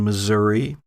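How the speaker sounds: ordinary talk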